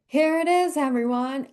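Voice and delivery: put-on high, loud showman presenting the baby; mock proud announcement. Husband showing off the baby to everyone, hammed-up proud announcement. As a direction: announce it to the crowd like a proud host.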